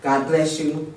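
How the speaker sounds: usual speaking voice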